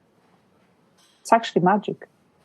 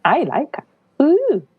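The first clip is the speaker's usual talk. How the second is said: excited; 7 semitones higher, slower than usual. Retold or imitated. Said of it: "it got me excited".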